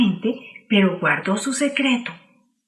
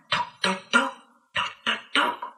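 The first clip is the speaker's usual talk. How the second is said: whispered knocking; 9 dB quieter, slower than usual. Acted. Knocking at the door again.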